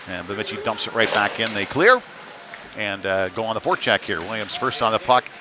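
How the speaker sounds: normal talking voice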